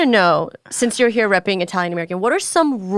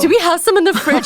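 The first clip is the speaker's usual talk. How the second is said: excited gasp; 8 semitones higher, 4 dB louder than usual. Real, not acted. Gasps at never having tried.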